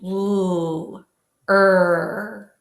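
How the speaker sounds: held L sliding into Er; L and then Er, tongue pulled back; L then R demo. Shown as a demonstration. Teacher leads the class through L then R. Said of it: "let's try it together".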